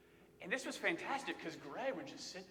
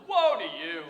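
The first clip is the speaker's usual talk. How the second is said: Woe to you much louder, woe punched; stressed woe; 12 dB louder than usual. Shown as a demonstration. Preacher contrasting the cooler Woe to you, louder. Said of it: "Not as cool as".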